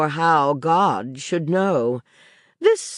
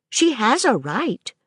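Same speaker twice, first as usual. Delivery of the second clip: put-on higher, light child's voice rising at the end; a child's hopeful rising tone; childlike Maisie; 8 semitones higher than usual. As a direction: voice the little girl pleading her case. Child Maisie echoes governess.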